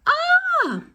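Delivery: very high, loud shriek; horrified shriek. Acted. Driver shrieks at the giant ice cream, very high. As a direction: shriek in horror. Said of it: "made him shriek with horror".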